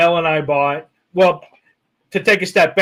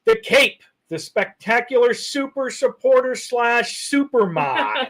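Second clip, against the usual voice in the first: high, slow, grand introduction; hype announcer; 6 semitones higher than usual. Real, not acted. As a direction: announce them like a star. Hyping up a viewer.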